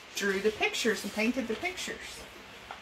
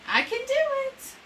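put-on much higher, eager child answer; little kid voice. A child in the story shows he can do it, high eager kid voice. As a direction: pipe it up like a proud small child.

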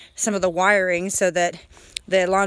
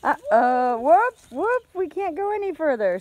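playful uh-oh; 6 semitones higher than usual. Pretend. Playful acting out short line to child.

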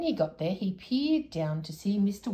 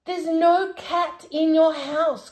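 slow, louder, emphatic protest; crying out; 10 semitones higher, 10 dB louder, much slower than usual. Acted. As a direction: spell it out loudly to the deaf man. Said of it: "cried Moonface".

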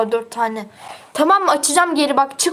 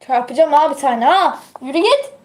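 annoyed scolding; 5 semitones higher than usual. Real, not acted. Scolding someone pestering in room.

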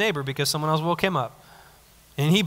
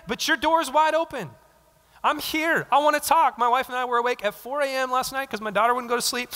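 bold eager prayer; 5 semitones higher than usual. Acted. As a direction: knock like you can't wait to talk. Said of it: "You should be bold in your prayer".

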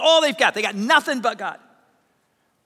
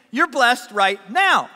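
slow, punchy proclamation; emphatic declaration; 4 dB louder, slower than usual. Retold or imitated. Preacher voicing Jesus to the congregation, emphatic declaration. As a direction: proclaim it firmly, word by word.

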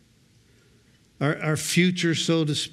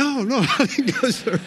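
a guilty laugh breaking through a high, louder denial; 8 semitones higher, 4 dB louder than usual. Retold or imitated. Husband laughing as he denies surfing to his wife. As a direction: caught out, laughing while he swears he didn't.

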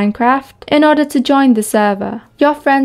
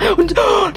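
quick high gasp of shock; high startled gasp; 8 semitones higher than usual. Real, not acted. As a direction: gasp in real surprise. Shock during gameplay.

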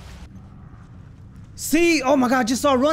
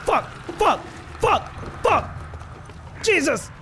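frustrated yelling; 3 semitones higher than usual. Real, not acted. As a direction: yell it out, furious at the game.

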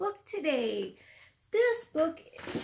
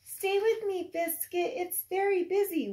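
usual speaking voice

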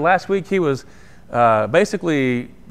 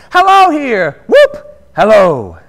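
giddy shout; 10 dB louder, slower than usual. Acted. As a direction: whoop it out like a giddy old man. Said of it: "laughing and crying in the same breath".

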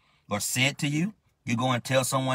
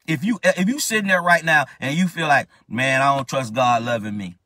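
preaching at full force; 6 dB louder than usual. Acted. Preaching challenge.

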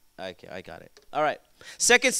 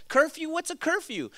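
high-pitched mock disbelief; scoffing teen; 8 semitones higher, 3 dB quieter than usual. Preacher mimics a teen dismissing parents' rules, scoffing. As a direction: play a teenager scoffing at rules.

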